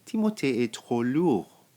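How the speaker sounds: usual speaking voice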